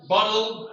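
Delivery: BOTTLE said loud and clear, with a distinct final L. Shown as a demonstration. Teacher modelling bottle for the class, clearly pronounced. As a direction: make the -tle of BOTTLE very clear. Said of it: "has to be very clear".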